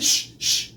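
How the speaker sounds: sharp "shh, shh"; two voiceless shushes; hushing. Retold or imitated. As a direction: imitate someone hushing for silence. Retelling friend hushing him.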